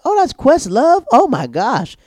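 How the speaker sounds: high, loud surprised shriek; high startled gasping voice; shocked realization. Retold or imitated. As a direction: shriek the discovery. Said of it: "is like".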